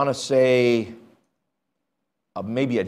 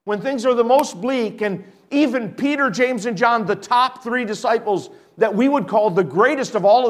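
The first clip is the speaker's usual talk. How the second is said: raised, fervent preacher voice; preaching; 7 semitones higher, 3 dB louder than usual. Acted. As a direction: preach it out over the congregation. Preaching at full force.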